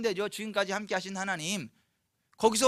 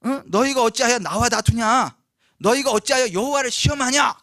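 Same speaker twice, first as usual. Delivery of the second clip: loud, raised-voice challenge; indignant rebuke; 4 semitones higher, 9 dB louder than usual. Acted. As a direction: scold the crowd with loud indignant questions. Acts hurt Moses rebuking the people.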